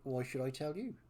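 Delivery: taunting West Country villain. Acted. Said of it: "doing a villain voice in West Country".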